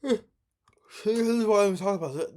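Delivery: talking through a yawn; words stretched by a yawn. Real, not acted. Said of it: "I'm glad I'm yawning".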